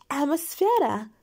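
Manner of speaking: slow, high spell cry; loud exclamation. A narrator's reading. Dina casts her power, high drawn-out exclamation. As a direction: stretch the magic word out loudly. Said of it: "воскликнула Дина".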